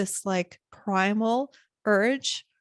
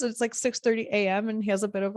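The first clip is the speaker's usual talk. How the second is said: ordinary talk, nothing marked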